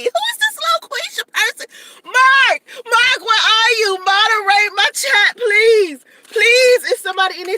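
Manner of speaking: high, loud shouts for help; calling out. Real, not acted. Streamer shouting for her moderator Mark, high and loud. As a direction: yell for the moderator across the room.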